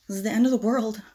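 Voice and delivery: normal talking voice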